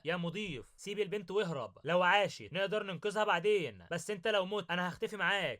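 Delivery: ordinary delivery, nothing marked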